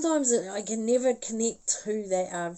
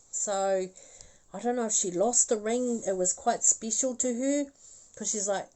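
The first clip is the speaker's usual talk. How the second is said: normal talking voice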